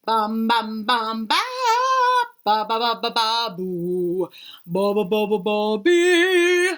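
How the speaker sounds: oom-pah the tuba notes out loud; imitating a tuba. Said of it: "I take out my tuba and I begin to play".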